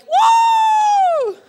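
imitated high-pitched squeal of delight; long, very high mock cheer; excited "woo!". Preacher imitating excited girls going for nuggets, high gleeful whoop. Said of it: "you guys would be like".

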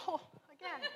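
high, soft, laughing groan; laughing exasperation. Retold or imitated. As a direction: laugh it off in disbelief. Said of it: "And I was like".

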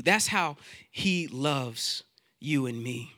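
usual speaking voice

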